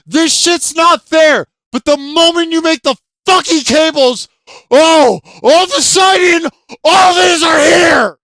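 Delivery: real frustrated shouting, voice rising to a yell; venting at full volume over the hidden quests; real angry shout. Real, not acted. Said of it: "trying to control the level of volume of my voice".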